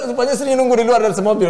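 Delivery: loud, high, amused telling; laughing. Real, not acted. Speaker reveals wife waited in the car, laughing. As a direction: tell it loudly through a laugh.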